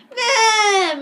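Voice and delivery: long, high mock wail; imitated yell. [name] answers his mom after school, mock drawn-out yell. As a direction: holler it out like a whiny kid.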